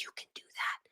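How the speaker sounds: whispered mock disbelief; imitated hushed awe. Kids hear she earns online, imitated whispered awe. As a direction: whisper it like an awed kid. Voicing awed kids' reply.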